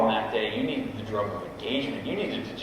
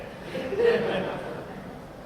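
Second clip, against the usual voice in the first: breathy high laugh; 4 dB quieter than usual. Real, not acted. Laugh after his joke.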